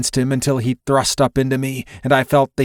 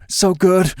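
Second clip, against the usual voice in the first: higher-pitched groan of pleasure; 7 semitones higher than usual. A narrator's reading. Lover groaning to his partner mid-embrace, high groan. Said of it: "He groaned".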